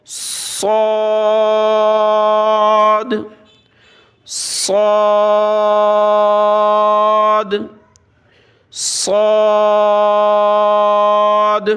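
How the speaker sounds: heavy, full صاد; heavy saad. Shown as a demonstration. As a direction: make صاد thick and hissing. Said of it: "اس کو ہم نے موٹا کر کے پڑھنا ہے".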